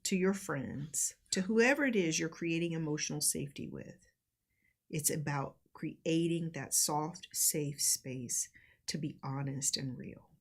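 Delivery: slow, measured delivery with long pauses; deliberate, reflective teaching read; reading out guidance. Acted. Reads out guidance on emotional safety.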